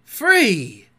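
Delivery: high, loud cry of disbelief; startled pirate echo; astonished. A narrator's reading. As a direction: call back incredulously. Smee astonished by the order.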